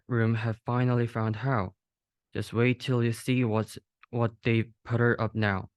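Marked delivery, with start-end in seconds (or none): none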